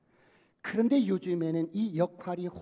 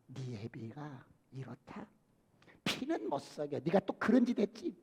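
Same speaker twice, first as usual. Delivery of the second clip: quiet disdainful mom; bitter scolding mother; 6 semitones lower, 8 dB quieter than usual. Retold or imitated. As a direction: sneer at the child about his dad. Mother bitterly running down father.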